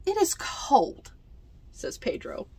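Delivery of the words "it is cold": put-on whiny child. Pedro complaining slowly.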